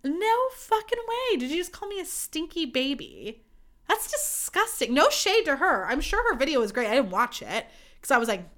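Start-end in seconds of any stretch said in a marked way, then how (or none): 0.0-4.9 mock disgust. Disgusted reaction to stinky baby.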